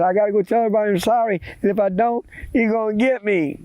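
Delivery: put-on high, rushed boy's voice; acted frightened babbling; panicked boy. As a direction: rush it out high and worried. Scared boy repeats the threat.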